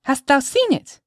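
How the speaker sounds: put-on very high, eager child's voice; little girl piping up with curiosity. A narrator's reading. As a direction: ask eagerly like a small child. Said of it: "eagerly asked little Pearl".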